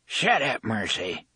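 put-on thick rural accent. A narrator's reading. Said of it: "he spoke with a thick accent".

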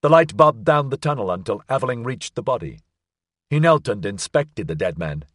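usual speaking voice

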